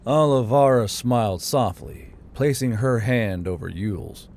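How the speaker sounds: ordinary delivery, nothing marked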